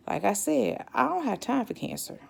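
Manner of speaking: usual speaking voice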